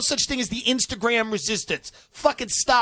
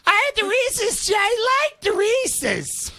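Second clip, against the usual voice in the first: much higher, whiny squeal; high squeaky confession to investigators; mock wife confessing; 12 semitones higher, slower than usual. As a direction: spill every dish she ate.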